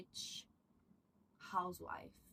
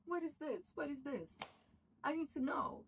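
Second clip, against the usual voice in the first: excited; 7 semitones higher than usual. Retold or imitated. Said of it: "I'm like".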